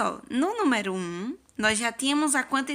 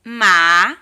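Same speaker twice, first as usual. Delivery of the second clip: Ma punched very loud; syllable beat; 2 semitones lower, 10 dB louder than usual. Shown as a demonstration. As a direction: hit the syllable Ma hard. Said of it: "Coloca o dedinho no queixo".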